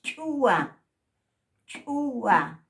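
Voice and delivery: "Chua" said high and loud with a glottal ch; glottal ch model. Shown as a demonstration. Teacher modelling the glottalized ch. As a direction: pop "Chua" from the throat. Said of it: "Sonido glotalizado".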